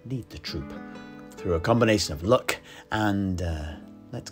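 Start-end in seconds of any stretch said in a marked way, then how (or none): none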